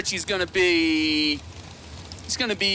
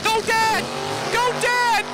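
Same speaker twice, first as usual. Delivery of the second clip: put-on cheering kid; slower than usual. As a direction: squeal it like a kid rooting for her dad. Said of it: "she's like".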